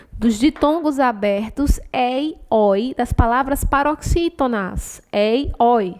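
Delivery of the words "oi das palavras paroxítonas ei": ordinary talk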